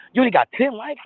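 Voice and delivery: high loud mock shock; mock surprise. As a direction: tease someone over few likes. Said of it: "Tell me like".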